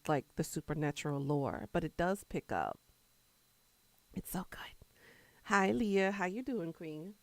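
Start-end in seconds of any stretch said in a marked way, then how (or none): 4.1-4.9 whisper. Real, not acted. Hushed aside about the show.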